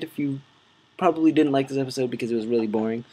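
usual speaking voice

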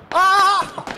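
sharp high loud cry of frustration; frustrated shout. Real, not acted. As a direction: yell at the near miss. Near miss in game.

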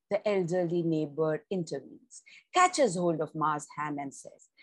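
measured storyteller's reading voice; story narration. A narrator's reading. Narrator reading a story to channel listeners, storytelling tone. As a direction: read the tale aloud to your listeners, evenly.

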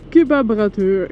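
"Kebabrateur" drawn out slowly, relishing each syllable; savored word. Pretend. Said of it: "Ik heb echt iets met dat woord".

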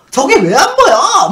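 high, loud, drawn-out indignant yell; acted flare-up of disbelief; angry outburst. Patient snaps at caretaker who sees no babies, angry yell. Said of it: "막 화를 내고".

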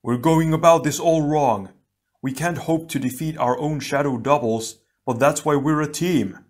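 rally the team with a shout; urgent shouted plea to teammates. A narrator's reading. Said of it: "he shouted".

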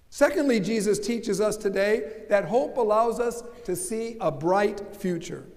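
normal talking voice

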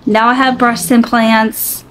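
normal talking voice

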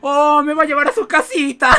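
high, loud delighted cry; thrilled at being led home; excited squeal. Real, not acted. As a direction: gush with excitement. Villager leads him home.

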